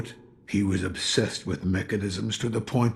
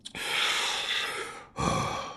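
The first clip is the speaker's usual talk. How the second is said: long sigh; 5 dB quieter than usual. Acted. Sighs recalling lost past.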